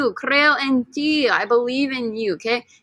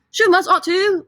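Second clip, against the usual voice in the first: higher, quick British accent; 9 semitones higher, 5 dB louder than usual. Shown as a demonstration. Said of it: "with a British accent".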